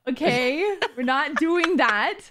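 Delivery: loud, high-pitched refusal; emphatic protest. Real, not acted. Refusing a friend's gross minion joke, emphatic protest.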